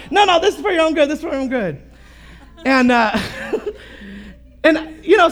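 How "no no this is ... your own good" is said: insistent grandma. Retold or imitated. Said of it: "she's like".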